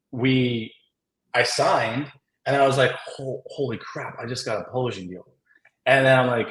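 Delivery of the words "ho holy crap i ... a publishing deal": hushed and quick, as if afraid to say it aloud; softer, rushed, awed under the breath; stunned disbelief. Retold or imitated. Realizing he just got a publishing deal, hushed and rushed.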